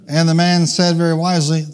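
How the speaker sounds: usual speaking voice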